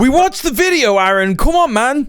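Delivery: high-pitched, incredulous objection; exasperated protest. Real, not acted. Streamer objects to commentator's account of the video, exasperated. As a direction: protest in disbelief, pitch jumping up.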